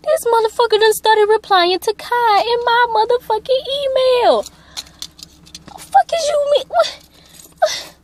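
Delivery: high-pitched indignant disbelief; retold outrage. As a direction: pitch shoots way up in scandalized fury. Retold outrage at discovering the emails.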